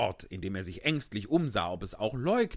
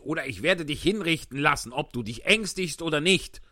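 angry; 7 semitones higher, 8 dB louder than usual. Acted. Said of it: "wiederholte der König ärgerlich".

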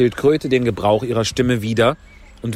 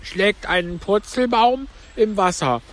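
high, plaintive turtle voice; Mock Turtle; 9 semitones higher than usual. A narrator's reading. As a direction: pitch it up for the tearful turtle. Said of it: "rief die falsche Schildkröte". (hohe Stimme der falschen Schildkröte)

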